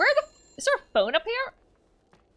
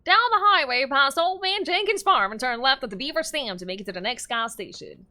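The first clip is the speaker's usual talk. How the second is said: American accent; 3 dB louder than usual. Shown as a demonstration. Streamer showing off their best American accent, hammed-up. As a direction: lay on a hammy American accent. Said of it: "that's my best American accent".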